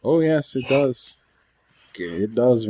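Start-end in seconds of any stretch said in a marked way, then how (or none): none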